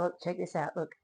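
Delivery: ordinary talk, nothing marked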